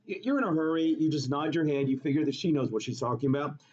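ordinary talk, nothing marked

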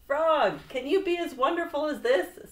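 high, loud showing off; boastful call. A narrator's reading. Toad dancing all over the stage, boasting loudly. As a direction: call out proudly to your friend.

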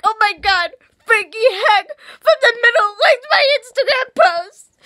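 high, loud retold shriek to mom; retold squeal. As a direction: scream it like telling mom. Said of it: "I'm like".

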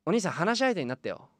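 childlike patient, lightly voiced; a lonely boy asking to chat.

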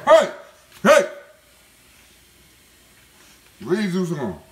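sharp loud shout to break up dogs; stern real yell at dogs. Real, not acted. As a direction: bark the command to get back. Yelling at fighting dogs.

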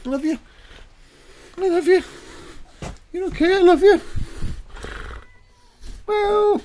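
high, loud cooing at the cat; doting pet voice. Real, not acted. Baby-talking the cat.

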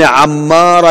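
عمارة with a after the ayn and a doubled M, ammara; slow, stress the double M in عمارة; عمارة said as ammara, held M. Shown as a demonstration. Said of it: "بالفتح والتثقيل".

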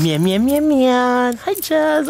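high, loud mock meows; meowing at the cat. Real, not acted. Owner meowing playfully at the cat, high mock meows. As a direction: meow back at the kitty high and loud.

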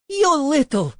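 high, loud insult cut off; enraged outburst. A narrator's reading. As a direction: start screaming an insult. Enraged woman cut off mid-insult.

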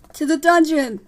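loud, high shout from a crowd; crying out. Acted. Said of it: "a voice cried out".

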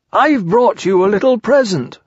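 bursting to hand over a present; excited Pooh. A narrator's reading. Pooh arriving with a gift for Eeyore, eager voice. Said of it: "said Pooh excitedly".

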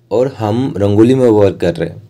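usual speaking voice

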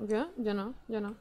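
ordinary delivery

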